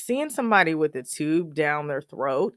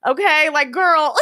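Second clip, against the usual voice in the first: exasperated exclaim; 11 semitones higher, 7 dB louder than usual. Real, not acted. Reacting to mom's matchmaking, a high loud mock shout. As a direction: cry out in amused disbelief.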